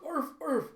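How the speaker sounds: high yappy barking imitation; dog barks. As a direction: bark after her like a little dog. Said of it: "you see Bandit go".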